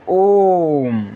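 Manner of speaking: ओम held out as a chant; long om. Shown as a demonstration. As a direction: intone ओम slowly. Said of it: "बोलने में ज़्यादा समय लग रहा है".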